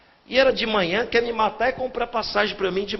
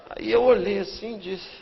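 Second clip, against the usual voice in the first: ordinary talk, nothing marked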